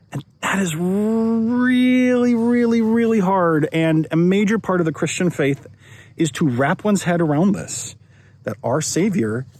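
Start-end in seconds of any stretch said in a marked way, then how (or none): 0.1-3.7 each "really" stretched very slowly and pitched much higher; drawn-out emphasis. Real, not acted. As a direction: lean hard on "really, really, really" like a heavy sigh of emphasis. Preacher stresses the difficulty.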